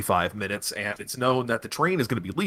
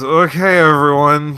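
loud, slurred tipsy heiress; drunk slurring; 4 semitones higher, 9 dB louder than usual. Acted. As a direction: slur it like she's had too much. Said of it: "She's slurring a little bit".

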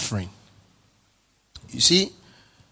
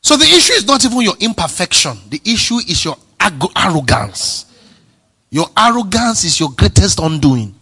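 high, loud preacher's climax; full-force preaching; 7 semitones higher, 7 dB louder than usual. Acted. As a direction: drive it home to the congregation.

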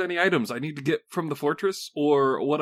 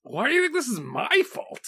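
higher, offended question; indignant; 9 semitones higher than usual. Acted. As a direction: protest the blame. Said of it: "Dag asked with indignation".

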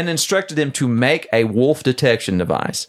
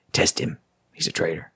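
hushed urging; 10 semitones lower, 6 dB quieter than usual. Retold or imitated. President secretly urging the test.

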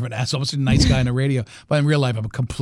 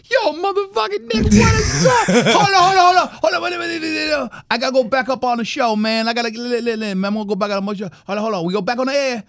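high, rowdy shouting that tumbles into babble; hyped ex-player off air; 8 semitones higher than usual. Retold or imitated. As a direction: cut loose like a cursing athlete on the phone. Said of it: "Was he Jamaican?".

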